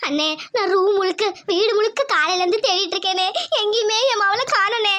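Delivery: hammed-up tearful lament; put-on crying. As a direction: plead through staged sobs. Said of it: "Lakshmi எதுக்கு அழுதுட்டு இருக்கா". (நடிப்பு அழுகையோடு முறையிடல்)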